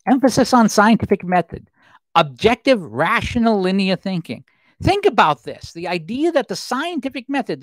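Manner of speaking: normal talking voice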